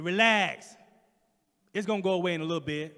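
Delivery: loud, fast dismissive order; curt nurse. Retold or imitated. Impatient nurse rushing the patient along. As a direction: rattle it off impatiently. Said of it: "She said".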